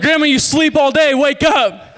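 put-on high, loud kid urging; impatient, thrilled yelling; excited wake-up shout. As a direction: holler to rouse a sleeper. Kid rousing sleepy grandmother.

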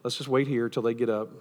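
low, soft understated sigh of dismay; quietly resigned, dropping away; mild mock dismay, low and subdued; deadpan Jesus. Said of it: "you can imagine Jesus being like".

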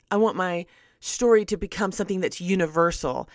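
ordinary talk, nothing marked

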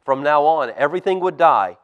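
put-on lively storybook reading for kids; reading to children. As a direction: tell it like a bedtime Bible story.

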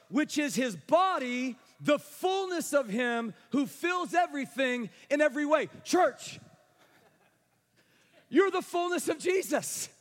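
high, loud, emphatic preaching; preaching at full force. Acted. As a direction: lift the room, drive each line home. Preaching to the church.

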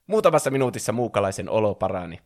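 ordinary delivery, nothing marked

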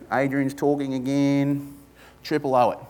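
lightly acted tedium; bored churchgoer. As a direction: drag it out as if tired of the preacher.

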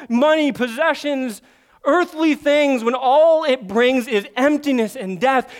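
loud, high, urgent preaching; fiery preaching. Acted. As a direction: preach it out with full force. Preaching at full force.

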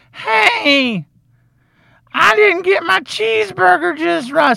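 high, whiny entitled brat; mock a spoiled kid complaining; squeaky, high spoiled customer; bratty kid voice.

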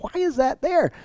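very high, puzzled question; comically perplexed and high-pitched; mock bafflement. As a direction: play the confused reader, voice way up. Acting out a baffled Bible reader.

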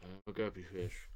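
talking with cheeks squeezed; squeezed cheeks, lips pushed out, soft; squished cheeks. Real, not acted. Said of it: "whose cheeks you're holding".

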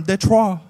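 loud French "Detroit", "deh-TRWAH"; "Detroit" said loudly the French way; French pronunciation. Acted. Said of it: "imagine me going around going".

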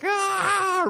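very high, loud shriek; shrill mock scream; little girls' scream. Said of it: "these little girls are like".

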